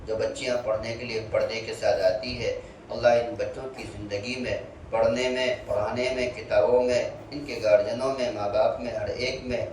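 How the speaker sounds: low, steady dua recitation; prayer. Acted. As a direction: pray quietly and humbly over the gathering. Closing dua after lesson.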